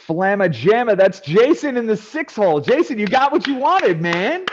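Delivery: high, loud announcer hype; hyped draft announcer. Acted. Host announcing Jason's draft slot, excited announcer. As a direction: build it up like a sports introduction.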